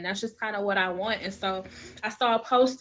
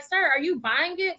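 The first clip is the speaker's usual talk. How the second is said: high, incredulous retort; snapping back at a texter who asks about dinner; mock annoyance; 7 semitones higher than usual. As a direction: scoff at him. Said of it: "You do, like".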